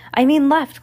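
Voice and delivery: high, loud urgent correction; quick correction. A narrator's reading. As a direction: blurt out a hurried correction. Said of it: "Klaus said quickly".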